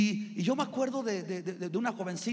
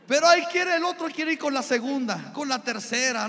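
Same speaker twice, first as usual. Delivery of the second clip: preaching shout; 4 semitones higher, 7 dB louder than usual. Real, not acted. Preacher mocks men wanting more wives.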